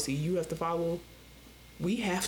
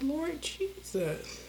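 weary lord jesus; 5 semitones higher, 3 dB quieter, much slower than usual. Retold or imitated. Exasperated exclamation, slow.

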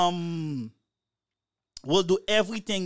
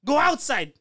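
high, loud mock exasperation; annoyed girlfriend; 7 semitones higher, 4 dB louder than usual. Woman ordering her robot boyfriend outside, acted fed-up outburst. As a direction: play someone who has had enough. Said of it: "She start getting annoyed".

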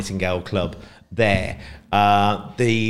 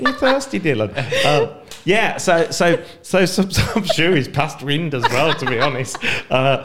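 laughing; 5 semitones higher, 4 dB louder than usual. Real, not acted. Laughing at the dog.

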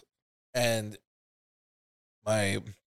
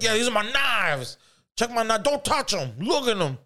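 high, strained, hammed-up dealer voice; possessive dealer; 10 semitones higher than usual. The dealer showing off his knives to a buyer, touchy and high-strung.

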